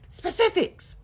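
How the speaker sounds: high, loud imitated yell; a husband's mimicked shout; retold yell. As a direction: belt it out like him yelling at her. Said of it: "then he starts yelling".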